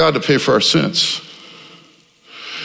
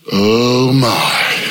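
hushed, lower awed "oh my"; awed gasp; 5 semitones lower than usual. Retold or imitated. Crowd awed at the heavens parting, hushed wonder. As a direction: breathe it out in wonder.

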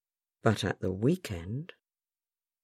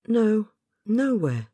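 put-on small, high, reluctant girl; a hesitant child, slowly; young girl voice; 10 semitones higher, 4 dB louder, slower than usual. Child Jane answers reluctantly.

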